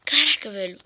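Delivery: loud excited celebration; raging gamer. Real, not acted. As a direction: shout it, thrilled. Loud celebration after winning.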